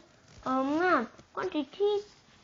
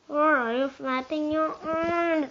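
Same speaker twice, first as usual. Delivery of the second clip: loud, slow, exasperated; shouted question; 4 semitones higher, 7 dB louder, slower than usual. Acted. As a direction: yell at him across the boat. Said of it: "I shouted at him".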